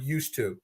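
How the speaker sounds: low falling used to; stress on used to. Shown as a demonstration. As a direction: lean on the words "used to" softly. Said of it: "And I stress that word".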